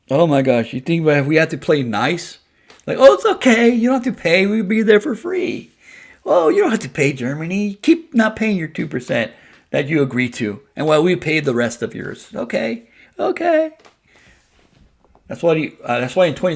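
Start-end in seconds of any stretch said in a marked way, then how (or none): 3.0-12.3 high, loud mock-sweet pushover voice. Mocking overly nice US leader. 12.3-13.7 very high mocking okay; mocking pushover squeak.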